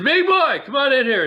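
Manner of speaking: high, loud summons; imitated call. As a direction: holler him into the office, half teasing. Sparky calling him into office.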